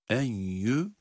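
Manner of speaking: nasal "enyü", as if with a nun; wrongly nasalised. Shown as a demonstration. Teacher repeating the mistake to avoid, nasal "enyü". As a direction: bring "enyü" from the nose with an n sound. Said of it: "genizden ses getirerek sanki bir nun".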